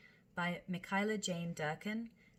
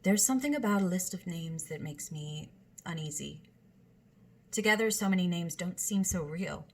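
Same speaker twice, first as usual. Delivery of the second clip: louder, steady dramatic reading; monologue reading; 8 dB louder than usual. Acted. Host reads a monologue aloud, a projected performance. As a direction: read each line out to an audience.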